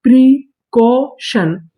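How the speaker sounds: Precaution stretched very slowly, each syllable held; drawn-out blend. Shown as a demonstration. Teacher showing students the whole word precaution, drawn out. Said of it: "तो पूरी meaning".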